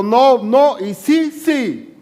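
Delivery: niño que llora no, madre responde sí aguda; niño llorando y mamá. Retold or imitated. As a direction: recrea la pelea de infancia, chillando. Recrea su llanto de niño y mamá. (niño llorando que grita no, y la madre que insiste en sí)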